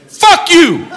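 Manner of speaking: high, loud mock-furious insult; angry shout. Stranger yells insult.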